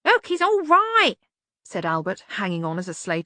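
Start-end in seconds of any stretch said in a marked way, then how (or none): 0.1-1.2 put-on high, loud cranky old man; startled old servant. A narrator's reading. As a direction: wake up alarmed and cranky. Albert voiced, pleading.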